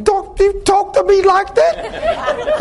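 high, drawn-out mock outrage; indignant wife. Wife scolding preacher over his gruff talk, mock indignant. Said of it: "She's like".

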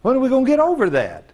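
high, emphatic preaching challenge; exasperated plea. Real, not acted. Preacher urging church to drop self-pity, high exasperation.

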